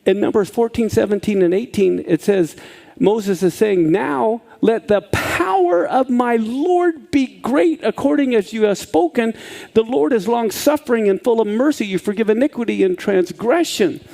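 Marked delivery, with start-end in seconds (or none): 3.9-14.0 solemn scripture reading. Acted. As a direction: read it like a prayer. Reading Moses' prayer aloud.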